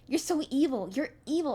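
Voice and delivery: high, loud accusing cry; furious accusation. Acted. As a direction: hurl the accusation at him. Violet accuses in fury.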